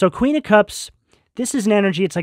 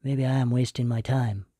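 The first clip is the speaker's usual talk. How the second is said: softer, lower, deflated murmur; mild imitation of someone giving up on themselves; discouraged self-doubt; 6 semitones lower, 10 dB quieter than usual. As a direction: sound like the doubt just sank in, quietly. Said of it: "You're like".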